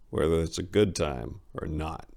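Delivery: plain reading voice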